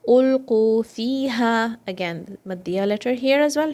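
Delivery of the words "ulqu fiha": Ulqu fiha slowly, lips rounded on qu; slow recitation. Shown as a demonstration. Teacher models Ulqu fiha slowly for students. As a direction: draw out Ulqu fiha for two counts. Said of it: "Make sure your lips are rounded".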